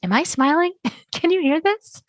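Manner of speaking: high, giggly questioning; laughing unsure voice. Pretend. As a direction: ask while laughing nervously. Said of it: "And you're like".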